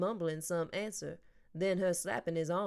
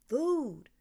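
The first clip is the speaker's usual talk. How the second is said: excited; 7 semitones higher, 4 dB louder than usual. Acted. Boy wakes at food word.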